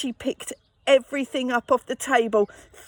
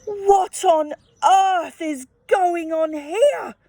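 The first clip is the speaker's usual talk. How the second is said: louder, higher and slow, a cross mum; angry shout; 5 semitones higher, 5 dB louder, slower than usual. Acted. Mum finding the mess, an angry slow shout. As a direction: demand it furiously, drawn out. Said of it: "she shouted".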